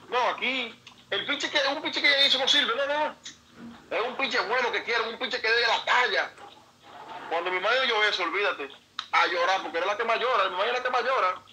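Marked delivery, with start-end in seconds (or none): 0.1-6.3 lightly voiced dismissive man, higher. Man at the meeting dismissing the boy, higher mock voice. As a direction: brush him off like he is nobody.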